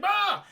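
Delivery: high sharp scream of shock; retold scream; startled scream. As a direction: cry out as the wave hits. Tidal wave hits him.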